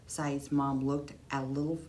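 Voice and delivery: ordinary delivery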